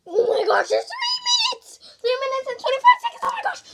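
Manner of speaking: high loud frantic shout with laughter; panicked laughing shout. Real, not acted. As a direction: yell the time in laughing panic. Screaming at remaining time.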